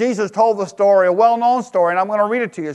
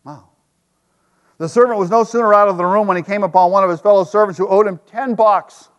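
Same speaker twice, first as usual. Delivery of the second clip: ordinary delivery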